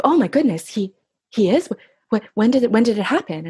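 mildly shocked, stammered questions; retold shock. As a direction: imitate someone taken aback, stumbling over words. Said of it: "he was so shocked".

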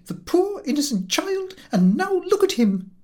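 indignant mother pushing her pitch far up; rising key. Acted. Mother laments her hairless son, pitch climbing high. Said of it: "her key continuing to rise".

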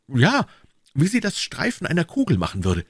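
high, eager confirmation; excited young D'Artagnan. Acted. D'Artagnan confirms the stranger's scar. (aufgeregter junger Mann, hohe Stimme)

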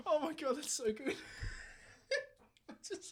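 high, breathless giggles barely getting words out; laughing too hard to speak; giggly. Real, not acted. Debater losing it at April Fools idea, wheezing giggles. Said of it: "I'm so giggly right now".

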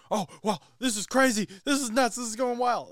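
high, excited hyped announcer; Tony Romo impression. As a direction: shout it like a thrilled commentator. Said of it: "Tony Romo being like".

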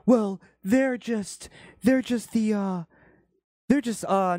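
high, slow, halting mock voice; put-on high voice, drawn out and hesitant; AOC. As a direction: imitate a politician searching for an excuse. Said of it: "that's where now A O C can go into".

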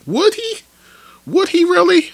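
sharp high disbelieving rise on "Would he"; incredulous. Real, not acted. Podcaster doubting the player fits Miami, incredulous high rise. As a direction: ask it like you can't believe it.

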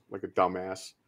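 ordinary talk

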